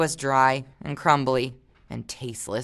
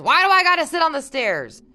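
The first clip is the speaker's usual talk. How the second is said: mock sulky whine, a bit louder; whiny complaint; 11 semitones higher, 7 dB louder than usual. Teacher mimics the sassy boy's whiny complaint. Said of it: "he kinda argued about it".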